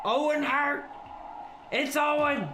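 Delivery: high-pitched, thrilled name call; excited call-out. Real, not acted. Streamer recognises Owen Hart in the game, excited. Spots wrestler during game.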